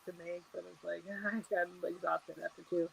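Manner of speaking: quiet, muffled and mumbled; muffled mumbling. Real, not acted. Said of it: "It got muffled again".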